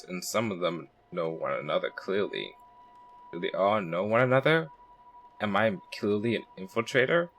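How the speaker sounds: quiet detective's inner thoughts; inner monologue narration. Acted. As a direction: think it through to yourself quietly. Reads character's inner monologue.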